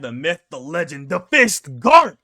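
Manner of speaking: hammed-up hype announcer. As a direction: belt the name like a wrestling intro. Corrected hype intro, announcer style.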